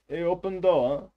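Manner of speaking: put-on loud, high Russian-accented demand; mock a pushy Russian at the buzzer; insistent Russian-accented calling; imitated Russian neighbour. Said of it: "all I get is".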